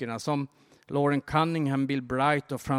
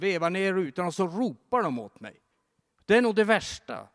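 higher, louder in bursts, a hurt plea; upset stranded man; 5 semitones higher, 4 dB louder than usual. Retold or imitated. Stranded man tells the driver of his ordeal, upset voice.

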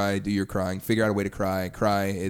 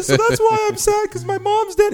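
much higher, louder whiny mock lament; mock whiny sad guy; 7 dB louder than usual. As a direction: whine it like a hammed-up sad boy. Mocking a crying guy.

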